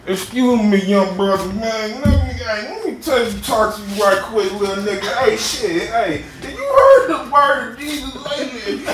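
hammed-up preacher hustling a stranger for Jesus; street preacher impression. Street preacher stopping a young man, lightly mocked. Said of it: "proselytizing man who refuses to look you in the eye".

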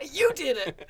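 laughing hard while talking; words squeezed out through laughter. Real, not acted. Laughing at a banned word.

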